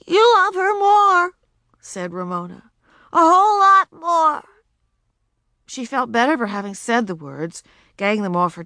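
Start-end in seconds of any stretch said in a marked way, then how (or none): 0.1-1.3 put-on high, loud accusing child; high loud wounded retort; hurt accusation. A narrator's reading. Child accusing her parents. 3.1-4.4 high, loud hurt shout; high loud wounded cry. A narrator's reading. Child shouting at parents.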